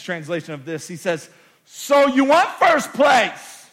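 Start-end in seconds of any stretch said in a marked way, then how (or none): 1.6-3.7 slow, loud proclamation of Jesus' words; loud, drawn-out preacher's quote; booming slow quote. Acted. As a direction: thunder the question out slowly. Quoting Jesus in sermon.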